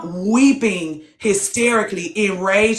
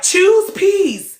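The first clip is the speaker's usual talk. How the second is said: mocking preachy advice; 10 semitones higher, 3 dB louder than usual. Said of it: "And they like".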